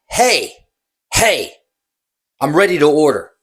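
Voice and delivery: mock demanding call for service; impatient customer call. Impatient customer calling the busy bartender, loud and demanding.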